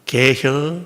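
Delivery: 개혀 said short and drawn-down in Chungcheong dialect, lightly voiced. Shown as a demonstration. Pastor demonstrates Chungcheong dialect to congregation, a light Chungcheong 개혀. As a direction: demo the clipped Chungcheong 개혀. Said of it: "충청도 말로 두자".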